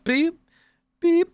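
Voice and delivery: high-pitched scanner beep; imitated checkout beep. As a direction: mimic a store scanner with a short high beep. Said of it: "It's just like, beep".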